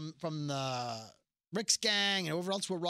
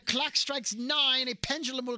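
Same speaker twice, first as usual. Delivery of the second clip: put-on old TV villain; 7 semitones higher than usual. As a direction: crow the evil plan like a campy Batman villain. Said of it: "All the villains are like".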